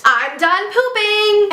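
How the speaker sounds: high, drawn-out sing-out holler; imitated child's scream. Girl announcing it as she flushes, high slow mock scream. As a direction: stretch it out like a kid proudly announcing. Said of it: "she screams".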